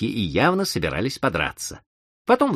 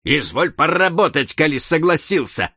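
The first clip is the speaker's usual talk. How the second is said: shouting; 7 semitones higher, 3 dB louder than usual. Acted. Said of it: "закричал дедушкин".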